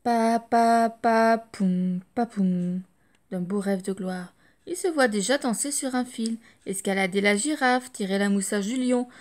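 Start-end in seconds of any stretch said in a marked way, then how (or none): 0.0-2.8 drum imitation. Imitating parade drum beat.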